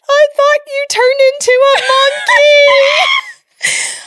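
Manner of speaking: put-on high, slow, loud wailing child's sob; imitating crying child. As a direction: wail it like a scared little kid crying. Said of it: "Started crying".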